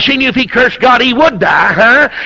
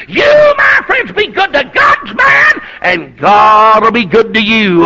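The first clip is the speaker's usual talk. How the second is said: full-force exhortation; 4 dB louder, slower than usual. Real, not acted. Preacher urging friends to honor God's man, full-force exhortation. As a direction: cry it out, then come down loud and firm.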